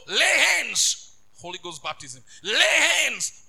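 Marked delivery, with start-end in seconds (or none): 0.1-1.1 loud, drawn-out preaching cry; preacher's shouted refrain. Real, not acted. Preacher urging laying on of hands, shouted slowly. 2.4-3.4 loud, drawn-out preaching cry; preacher's shouted refrain. Real, not acted. Preacher urging laying on of hands, shouted slowly.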